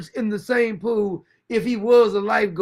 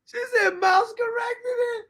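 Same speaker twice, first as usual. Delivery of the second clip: laughing disbelief; 13 semitones higher than usual. Real, not acted. Shocked at the absurd story.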